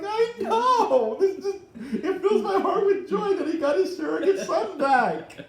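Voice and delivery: high-pitched laughing gush of joy; giddy laughing gush about a happy reunion. Retold or imitated. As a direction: squeal it through laughter, overjoyed. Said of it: "I'm like".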